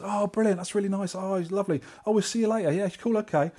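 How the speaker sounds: high, pleased imitation; friendly stranger. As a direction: act out a chirpy, approving local. Acting out friendly stranger's reply.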